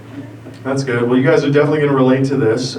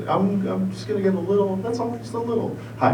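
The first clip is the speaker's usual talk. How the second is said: high, soft little voice; coaxing himself; 6 semitones higher, 9 dB quieter than usual. Retold or imitated. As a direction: softly bargain for just a tiny bit. Acts coaxing himself into little weed.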